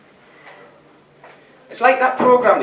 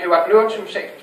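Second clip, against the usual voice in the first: usual speaking voice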